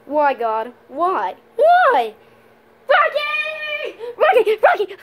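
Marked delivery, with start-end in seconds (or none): 1.6-5.0 calling out. Acted. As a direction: shout for Rocky in despair. Desperate calling out.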